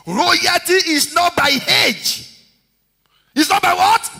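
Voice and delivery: high, loud, slow preaching; call-and-response preaching; pulpit call. Acted. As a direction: drive the line home.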